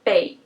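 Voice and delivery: "bei," said very low and light, no rise. Shown as a demonstration. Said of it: "very light, very low".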